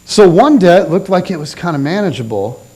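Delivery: ordinary talk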